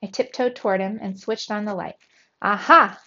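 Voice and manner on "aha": sudden loud "gotcha" cry; cried out. A narrator's reading. Mouse catching the fridge thief in the act, crying out. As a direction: pounce on it, loud and triumphant. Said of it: "I cried, ready to strike".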